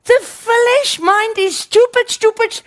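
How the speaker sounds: high, slow, loud stupid; emphatic cry. Real, not acted.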